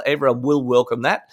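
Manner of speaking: ordinary delivery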